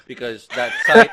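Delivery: laughing. Real, not acted. Podcaster mocking an outdated streaming site, laughing. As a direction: start cracking up mid-sentence.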